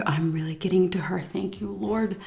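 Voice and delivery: a secret, hopeful whisper to herself; whispered thought. Retold or imitated. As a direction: whisper it under your breath. Inner thought during tense lunch.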